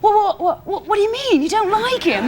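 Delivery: high, stammering mock British bafflement; put-on English voice, lifted and incredulous; Eddie Izzard. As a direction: imitate a flustered English caller. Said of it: "as Eddie Izzard goes".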